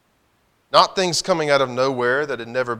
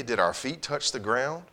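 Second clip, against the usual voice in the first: usual speaking voice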